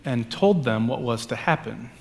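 measured, solemn gospel reading; scripture reading. Acted. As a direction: liturgical reading voice. Reads the gospel passage aloud.

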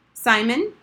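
sharp, loud call of the name. A narrator's reading. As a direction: snap the name to make him jump. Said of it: "Miss Elson spoke quite sharply".

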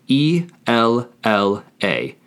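unhurried, letter by letter; spelled out. Shown as a demonstration. Teacher spelling the word for she, slowly spelled out. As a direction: spell it with a pause after each letter.

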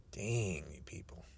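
breathy disgusted whisper; muttered dismissal; whispered exasperation. Real, not acted. Exasperated aside.

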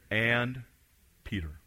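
"Peter" said very soft, falling, set apart; hushed emphasis. Acted. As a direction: drop to a near hush on "And Peter". Pastor isolates key phrase.

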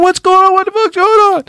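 high alarmed yell; dad screaming in alarm. Retold or imitated. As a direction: scream it like a startled father. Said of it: "He's screaming".

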